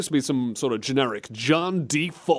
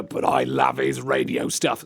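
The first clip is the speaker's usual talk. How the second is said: very low put-on character voice; mock-smitten, pitched way down; smitten Susan; 13 semitones lower than usual. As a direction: play the adoring fan in a deep voice. Playing Susan.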